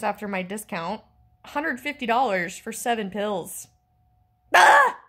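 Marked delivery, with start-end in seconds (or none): 4.5-5.0 short, high, loud scream; sudden high yell of frustration; exasperated scream. Real, not acted. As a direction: burst out in outrage at the price. Outraged at the price.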